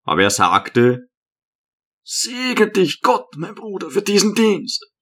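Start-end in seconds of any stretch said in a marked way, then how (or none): 2.0-4.9 hermit blessing the brother who shoved him, meek; meek old hermit. A narrator's reading.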